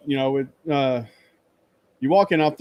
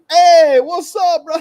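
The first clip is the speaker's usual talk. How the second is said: much higher, louder hey to a friend; loud excited greeting; 12 dB louder, much slower than usual. Retold or imitated. Tapping a YouTuber on the shoulder, loud excited greeting. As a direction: call it out like spotting a buddy. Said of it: "tapped him on the shoulder and went".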